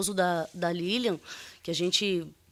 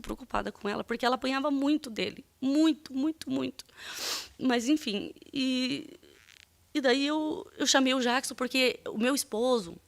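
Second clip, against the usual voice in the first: higher, strained with real emotion; upset; 5 semitones higher than usual. Real, not acted. Witness recalling her friend's beatings, upset. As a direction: voice rising as distress takes hold.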